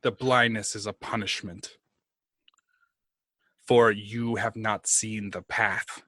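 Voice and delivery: slow, solemn sentence of doom; stern dark lord. Acted. Posing as a tyrant god sentencing a blind servant, solemn. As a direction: pronounce the punishment with long pauses.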